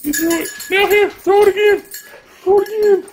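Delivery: very high, playful pup chant; be the dog begging for the throw. Pretend. Voicing the dog.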